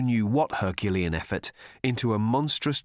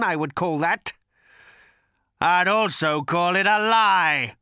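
bitter creature voice; 6 semitones higher, 3 dB louder than usual. Acted. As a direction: sneer it like a wronged beast. Agrajag accusing Arthur.

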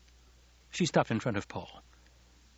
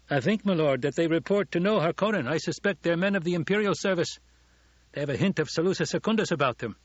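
higher, louder character voice; retainer reporting; 7 semitones higher, 4 dB louder than usual. A narrator's reading. Gurney shares suspicions with his lord, higher retainer's voice.

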